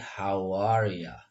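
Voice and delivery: slow "how are ya", ending in an A sound; you becomes ya. Shown as a demonstration. Teacher models American English, you becomes ya. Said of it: "you-nya ini biasa lebih cenderung mendekat ke A".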